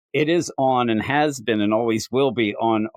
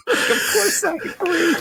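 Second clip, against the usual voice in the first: very high, silly mock voice; self-mocking jerk. Mocking his own replies to song comments, silly jerk voice. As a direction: squeak out a hammed-up agreeable reply. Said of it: "I sound like a jerk".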